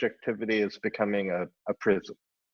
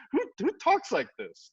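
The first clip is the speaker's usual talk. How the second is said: incredulous; 13 semitones higher than usual. Real, not acted. Mocking self-referential architects.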